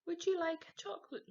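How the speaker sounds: nervous offer. Acted. Peter offering chocolates to Mum, fast and jittery. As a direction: rush it out with nerves.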